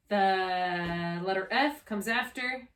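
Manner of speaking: drawn-out question inviting the answer; slow teacher prompt. Shown as a demonstration. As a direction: stretch it out, slow, so the child fills the gap. Tutor prompts the next letter.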